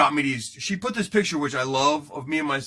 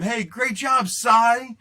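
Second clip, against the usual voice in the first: higher-pitched mock cheer to the pitcher; retold yell; 7 semitones higher, 4 dB louder, much slower than usual. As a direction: call out like a fan in the stands. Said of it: "you yell to DeGrom".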